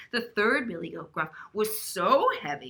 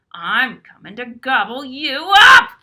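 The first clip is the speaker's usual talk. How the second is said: troll roar; 4 semitones higher, 16 dB louder than usual. Acted. Troll voiced in story.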